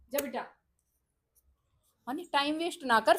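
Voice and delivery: high, urgent telling-off; impatient scolding. Real, not acted. Mother urging son not to waste time. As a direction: snap him out of dawdling.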